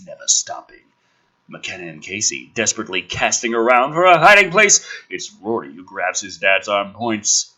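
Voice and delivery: normal talking voice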